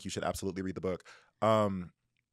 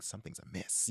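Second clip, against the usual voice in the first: whispered, much softer, mock ominous; spooky whisper; 11 dB quieter than usual. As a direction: breathe it like a ghost story. Voicing the heroine's suspicion.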